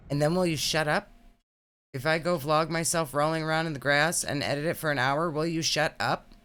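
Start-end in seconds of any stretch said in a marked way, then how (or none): none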